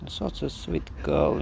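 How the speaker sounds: deep, fond croon; low cooing praise. Real, not acted.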